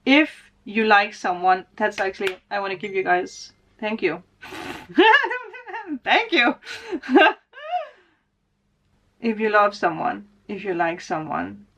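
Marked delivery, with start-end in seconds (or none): 4.4-6.0 high laugh close to tears; high emotional laugh. Real, not acted. Vlogger thanking her viewers, laughing emotionally. 6.0-7.3 teary laughing thanks. Real, not acted. Tearful thanks to viewers.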